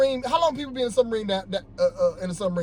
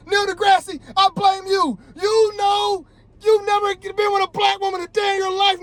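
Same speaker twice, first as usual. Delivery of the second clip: angry shout; 10 semitones higher than usual. Real, not acted. Vlogger shouting at Neil deGrasse, furious and high. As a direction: blast him, you're fed up. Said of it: "Sorry for getting mad".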